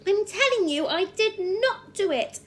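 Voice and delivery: high, loud screamed insistence; screamed denial. Acted. Matilda screams at the headmistress that she is innocent. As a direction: scream it, hammering did not. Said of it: "Matilda screamed".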